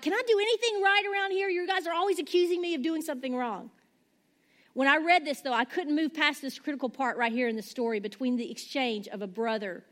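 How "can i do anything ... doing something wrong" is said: put-on high, fast put-upon little kid; whiny baby of the family. Pastor as youngest child voicing his family frustration, whiny. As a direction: complain like the accused youngest child. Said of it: "Man, I always felt like".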